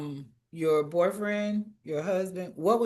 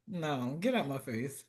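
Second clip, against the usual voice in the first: ordinary talk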